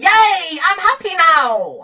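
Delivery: drawn-out, mock-relieved cheer; mock happy victim. Mocking a victim who logged off, fake happy. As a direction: play a crybaby suddenly cheered up.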